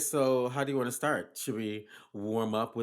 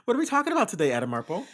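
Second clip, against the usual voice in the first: high, bright host voice; high host announcement; 8 semitones higher, 8 dB louder, much faster than usual. Acted. Host presenter voice.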